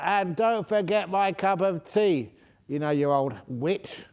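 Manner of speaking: loud, high, slow rude shout; shouted rudely. Retold or imitated. Tea passenger copies the rude man, shouting at the attendant. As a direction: yell it across the cabin, rudely. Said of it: "so he shouted out".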